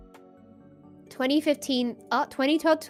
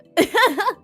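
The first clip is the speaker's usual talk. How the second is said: laugh; 10 semitones higher, 6 dB louder than usual. Real, not acted. Laugh after retelling.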